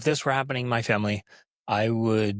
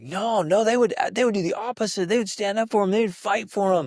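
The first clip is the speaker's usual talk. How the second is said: put-on kid's voice; 11 semitones higher, 4 dB louder than usual.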